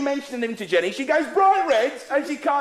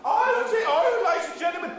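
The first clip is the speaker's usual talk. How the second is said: mock impressed; 7 semitones higher than usual. Mocks audience member as posh.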